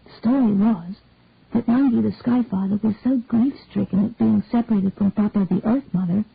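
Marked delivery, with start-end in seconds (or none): none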